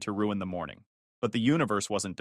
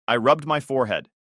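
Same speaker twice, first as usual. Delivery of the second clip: usual speaking voice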